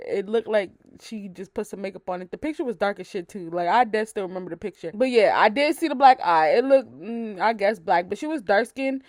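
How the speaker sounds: ordinary talk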